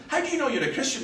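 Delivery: high, loud challenge, imitated; accusing heckler. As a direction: throw it out as an accusation. Acts out heckler challenging people.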